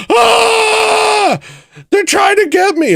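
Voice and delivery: high, loud terrified scream of someone being chased; panicked shriek. Pretend. Tourist mocked by watching locals, screaming in panic. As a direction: shriek like a tourist running for his life.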